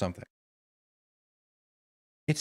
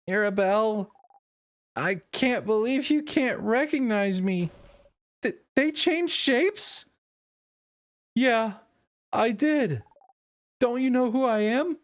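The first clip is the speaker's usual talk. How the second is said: a touch higher, playful god voice; lightly voiced god; 7 semitones higher than usual. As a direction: tease as the hidden god. Voicing the Change God character.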